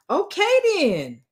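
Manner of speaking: high drawn-out mock "okay then"; mock imitation. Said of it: "the best you got is".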